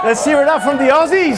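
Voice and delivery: rally the Aussies in the room; crowd call. Real, not acted. Calling out to the audience.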